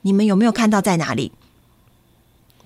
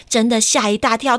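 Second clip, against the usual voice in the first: plain reading voice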